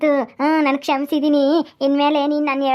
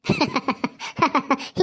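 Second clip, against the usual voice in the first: laugh; 4 dB quieter than usual. Acted. Laughs at Jayamma.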